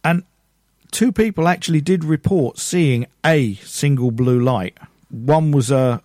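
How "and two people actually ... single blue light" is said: normal talking voice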